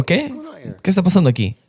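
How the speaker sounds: un "¿Qué?" agudo y desconcertado; sorpresa imitada. Retold or imitated. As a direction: tomado por sorpresa, sin entender. Sorprendido por el grito del obrero. (al obrero que le grita: sorpresa aguda)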